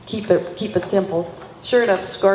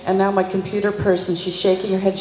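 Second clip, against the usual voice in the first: ordinary talk, nothing marked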